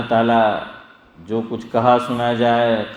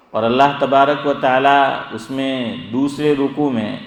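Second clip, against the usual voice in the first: ordinary talk, nothing marked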